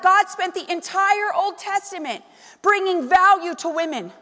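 high, loud pulpit delivery; forceful preaching. Real, not acted. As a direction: drive each point home to the congregation.

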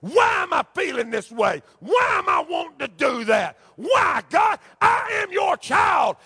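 impassioned, pleading pulpit cry; preacher acting out anguished questions to God. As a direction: cry out the questions with climbing pitch. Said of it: "crying out to me, saying".

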